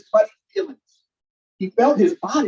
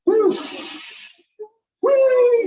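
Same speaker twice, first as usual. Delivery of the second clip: high, rising squeal of delight; excited whee. A narrator's reading. Little sister cheering the flight, high excited squeal. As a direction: squeal like a kid on a ride. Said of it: "said Nika".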